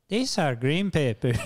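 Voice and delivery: slow, put-on Ecuadorian accent. Cook answers a teasing coworker, slow imitated Ecuadorian accent. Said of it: "dude from Ecuador".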